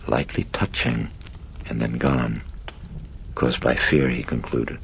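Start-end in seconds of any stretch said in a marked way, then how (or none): none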